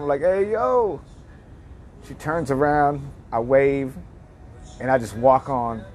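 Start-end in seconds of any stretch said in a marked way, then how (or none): 0.2-1.0 mild calling-out, voice pitched up; drawn-out high call, not truly shouted; retold yell. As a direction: imitate hailing someone down the street. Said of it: "And I yell her name".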